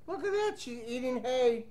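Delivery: ordinary delivery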